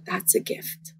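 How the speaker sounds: God's reply barely whispered; hushed whisper. Retold or imitated. God answering her under the water, whispered. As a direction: drop to a soft, gentle whisper.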